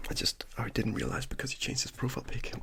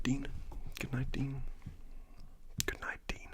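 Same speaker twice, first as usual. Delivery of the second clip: ASMR whisper; slower than usual. Acted. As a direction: whisper good night gently, like ASMR.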